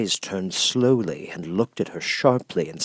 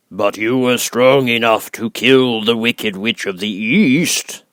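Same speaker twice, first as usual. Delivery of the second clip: booming, much louder, very slow voice of the giant head; great and terrible Oz; 3 semitones higher, 7 dB louder, slower than usual. Acted. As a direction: loom over her, slow and thundering. Oz answers.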